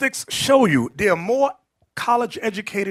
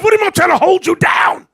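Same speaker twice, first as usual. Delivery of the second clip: much higher and louder, shouting it out; indignant shout; 11 semitones higher, 9 dB louder, faster than usual. Retold or imitated. Just-released man yelling at a feminist, indignant shout. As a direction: yell it back in outrage.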